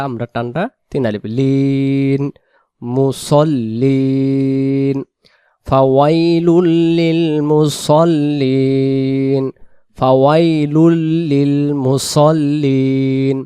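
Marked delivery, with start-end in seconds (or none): 1.4-13.4 slow chanted recitation; Quran recitation. Shown as a demonstration. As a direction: stretch মুসল্লিন long. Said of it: "recites the verse in tajweed". (ধীর তিলাওয়াত)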